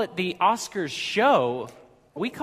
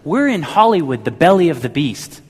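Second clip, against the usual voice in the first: retelling a quote loudly; 10 dB louder than usual. Retold or imitated. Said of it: "So he says".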